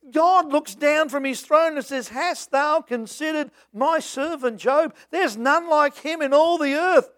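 proud God. Retold or imitated. God boasting about Job to the devil, lightly voiced proud pride. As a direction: let God brag about his servant. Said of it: "here's God bragging about a man".

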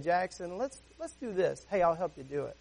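soft, coaxing dad voice; gentle redirection. Retold or imitated. Dad gently redirecting his son Jackson, soft and encouraging. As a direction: gently invite a child to join in, quietly. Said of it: "if you gently redirect".

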